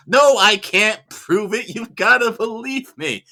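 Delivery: loud, high, drawn-out imitation of pleading; acted desperate plea. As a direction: mock-desperate shout, stretching the words. Quotes film hero's desperate phone plea.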